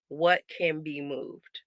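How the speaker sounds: slow, soft pondering. Retold or imitated. Mom checking her calendar, slow and musing. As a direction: think it over slowly. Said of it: "I'm like".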